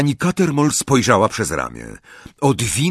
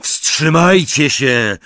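loud, drawn-out command; raised, slow, booming shout; roared; 6 semitones higher, 5 dB louder, much slower than usual. A narrator's reading. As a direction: roar the order across the hall. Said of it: "ryknął Harry".

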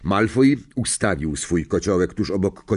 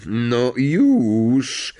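higher, drawn-out ominous command; menacing snarl; 4 semitones higher than usual. A narrator's reading. Professor orders Ron to swap roots, snarling. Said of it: "warknął Snape złowrogim tonem".

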